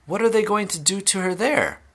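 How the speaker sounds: high-pitched worried question; alarmed cry. A narrator's reading. Mother fearing for her daughter, crying out. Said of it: "cried Missus Beauregard".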